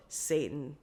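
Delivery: low, quiet and toneless; monotone. Retold or imitated. Woman retelling waking from dead sleep, monotone. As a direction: intone it softly without feeling. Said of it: "said in a monotone voice".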